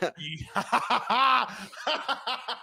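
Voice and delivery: long, rolling burst of laughter; real hearty laugh. Real, not acted. Laughing at co-host's age.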